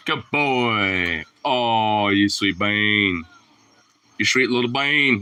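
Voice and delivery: slow, drawn-out praise for a pet; doting coo. Real, not acted. Owner praising sweet little dog, slow and doting. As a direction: coo over the dog, stretching the words.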